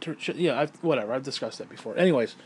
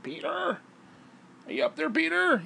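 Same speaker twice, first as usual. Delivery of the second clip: much higher nagging call; imitating the neighbour calling upstairs; calling old woman; 13 semitones higher than usual. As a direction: call up the stairs in a high, insistent voice.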